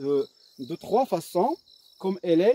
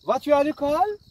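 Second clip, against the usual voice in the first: Vas-tu à l'école? much higher and louder, climbing on à l'école; emphatic question tune; 9 semitones higher, 7 dB louder than usual. Shown as a demonstration. Teacher demonstrates the inversion question, high and rising. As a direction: exaggerate the rise for the class. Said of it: "Le changement de l'intonation".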